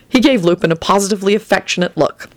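ordinary delivery, nothing marked

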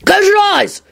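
high urgent cry; yell. A narrator's reading. William warning Rosie as he sits down, yelling. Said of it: "William yelled".

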